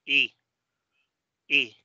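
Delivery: I said as a pure "ee"; like Latin i. Shown as a demonstration. Teacher showing English E sound, I as "ee". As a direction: say I as Spanish i, each one set apart.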